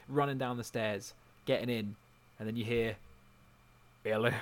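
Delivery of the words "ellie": a hint of gruff Joel calling Ellie; lightly voiced Joel, calling out her name; Joel impression. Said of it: "That was my impression of Joel".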